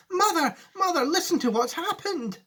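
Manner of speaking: put-on high, breathless excited boy; excited child. A narrator's reading. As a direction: tumble the news out in a rush. Charlie excited, tumbling words.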